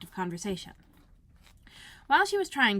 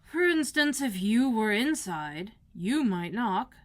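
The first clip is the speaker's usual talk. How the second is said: slow and louder, over-patient; slow pompous footman; 2 semitones higher, 5 dB louder, slower than usual. Acted. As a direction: spell it out to her slowly. Footman explains slowly.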